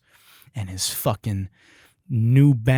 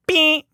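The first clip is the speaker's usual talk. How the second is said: high loud beep imitating an air horn; 14 semitones higher, 6 dB louder than usual. Imitating the union guys' air horn, high loud beep. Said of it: "they start meep".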